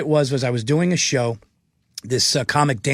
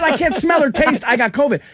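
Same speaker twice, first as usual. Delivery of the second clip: laughing insistence; 9 semitones higher than usual. Retold or imitated. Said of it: "I just said".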